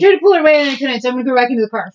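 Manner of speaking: mocking. Mock her flimsy excuse.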